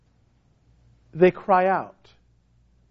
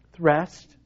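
ordinary talk

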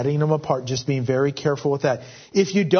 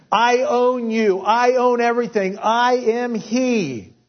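proclaiming God; 5 semitones higher than usual. Acted. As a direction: thunder out God's claims, slowing at the end. Preacher voices God proclaiming ownership.

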